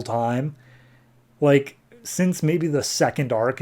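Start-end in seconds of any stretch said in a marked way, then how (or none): none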